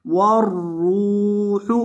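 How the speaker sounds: वर्रूहु with a thick, full ra held long; heavy ra. Shown as a demonstration. Teacher demonstrating the heavy ra to students. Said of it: "रॉ को आपने मोटा भी करना है".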